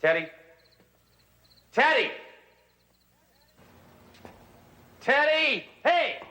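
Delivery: loud, high calls for a missing man; calling out. Acted. Detective calling out for the missing suspect Teddy, loud and high. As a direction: shout his name across the house.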